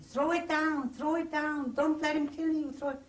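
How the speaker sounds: high, loud pleading yell to drop it; retold urgent shouting. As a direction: shout the warning as the crowd did, urgent and high. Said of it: "And everybody was telling".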